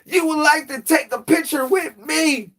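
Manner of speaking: mock Times Square woman inviting a photo; flirty come-on. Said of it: "They be like".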